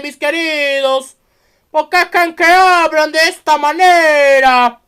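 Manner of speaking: loud, high hammed-up Italian mobster accent; bad Italian accent. Vlogger playing a Godfather mafioso in a hammed-up Italian accent. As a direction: play an elegant Italian mafioso, badly. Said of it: "lamento que mi acento italiano sea malo".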